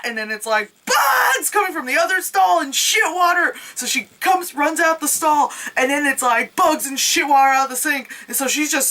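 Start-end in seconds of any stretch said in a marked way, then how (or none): 0.8-3.5 mock panic. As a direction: blurt it out in fake alarm. Narrating the movie scene.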